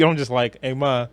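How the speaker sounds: ordinary talk, nothing marked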